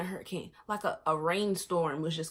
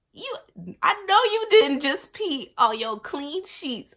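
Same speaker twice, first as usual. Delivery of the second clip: louder, higher, slowed, scolding outrage; mad; 9 semitones higher, 10 dB louder, slower than usual. Retold or imitated. Scolding herself for peeing on clean sheets, indignant and loud. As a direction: tell yourself off, indignant and drawn out.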